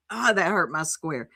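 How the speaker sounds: ordinary delivery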